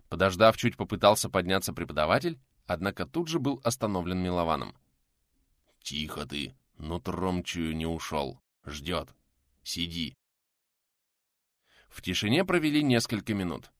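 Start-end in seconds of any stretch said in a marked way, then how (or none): 5.8-10.2 low, hushed and tense; hushed warning. Acted. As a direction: keep it down, danger is near. Hiding, warning to stay still.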